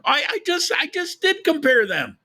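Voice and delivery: very high, squeaky comic voice; mock protest. Streamer jokingly answering a viewer's comment, high-pitched mock protest. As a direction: shoot up high in joking protest, falling at the end.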